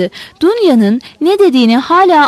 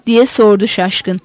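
plain reading voice

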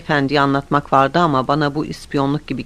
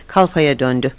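plain reading voice

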